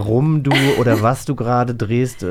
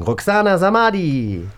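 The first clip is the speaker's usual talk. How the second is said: higher, slow, drawn-out introduction; announcing the guest; 7 semitones higher than usual. Real, not acted. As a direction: present her like a showmaster.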